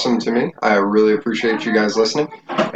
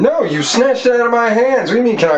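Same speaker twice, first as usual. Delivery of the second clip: high-pitched, indignant objection; indignant protest; 10 semitones higher than usual. Real, not acted. Protesting to the one who snatched it, indignant. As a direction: push back hard, pitch jumping up. Arguing over who puts it back.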